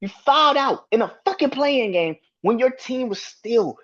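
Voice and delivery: high-pitched exasperated ranting at a player; ranting in real disbelief, voice pitched well up; real angry rant. Real, not acted. Fan venting at Draymond over the foul-out, real angry high rant. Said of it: "that shit pissed me off".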